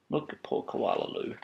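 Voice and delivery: usual speaking voice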